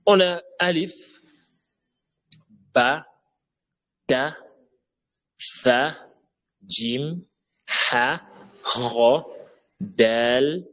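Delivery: each letter named slowly and clearly, one by one; reciting the Arabic alphabet. Shown as a demonstration. Teacher recites the Arabic alphabet for students to copy. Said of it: "on va lire l'alphabet".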